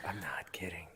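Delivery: very soft breath-only whisper; mock secret whisper. Co-host secretly taking back his "kidding", mock whisper. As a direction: whisper it under your breath.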